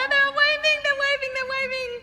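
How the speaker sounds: high, delighted calling out as they wave; excited. Real, not acted. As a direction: cheer them on as the camera pans. Camera pans to waving choir.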